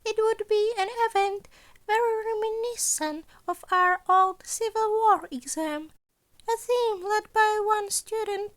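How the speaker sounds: a sly, chipper school principal asking; Nezu's voice. A narrator's reading.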